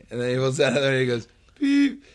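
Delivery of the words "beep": a sudden high, loud electronic beep; high mock beep. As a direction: squeak out the card reader's beep. Imitating card reader beep.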